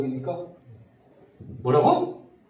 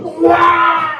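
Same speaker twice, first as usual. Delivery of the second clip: much higher, loud exaggerated exclamation; delighted wow; 11 semitones higher, 7 dB louder, much slower than usual. Shown as a demonstration. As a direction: overreact with big delight. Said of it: "그러면". (크게 호들갑 떠는 감탄 시범)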